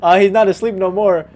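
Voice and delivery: high, loud, alarmed announcement. Real, not acted. Player seeing the baby suddenly awake, loud alarm. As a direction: blurt it out in alarm.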